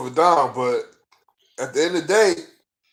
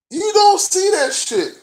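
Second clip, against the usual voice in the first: high, loud frustrated outburst; worked-up fan at the end of patience; exasperated; 5 dB louder than usual. Real, not acted. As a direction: cry it out in real exasperation, pitched way up. Frustrated rant about the players.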